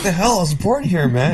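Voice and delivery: high-pitched mock outrage; indignant protest. Co-host plays the man being deported for winning at golf, indignant.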